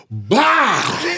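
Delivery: high, explosive "bah" crash; imitated explosion. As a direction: burst out a drawn-out crash noise.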